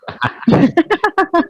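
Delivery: high-pitched burst of laughter; amused laugh. Real, not acted. Host laughing over his unstable internet connection, amused laugh.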